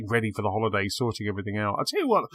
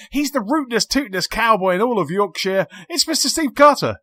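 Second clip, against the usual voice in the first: big game-show announcer build-up, much higher and louder by the end; hyped cowboy introduction; 12 semitones higher, 6 dB louder than usual. Acted. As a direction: sell the guest like a rodeo announcer. Host hyping guest introduction.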